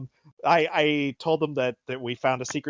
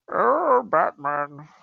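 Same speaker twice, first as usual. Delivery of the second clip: Bane impression; 4 semitones higher, 4 dB louder, much slower than usual. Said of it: "a Bane impression".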